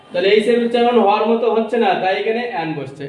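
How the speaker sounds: normal talking voice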